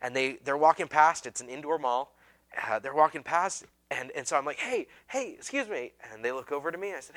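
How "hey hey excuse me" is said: high, eager hey to strangers; eager calling out. Retold or imitated. Young preacher hailing strangers at the mall, eager. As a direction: call out to passersby, keen and nervous.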